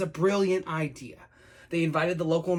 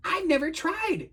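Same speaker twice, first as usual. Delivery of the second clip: small, high timid dragon voice; slow, high and a little frightened; 11 semitones higher, slower than usual. Acted. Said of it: "made him tremble".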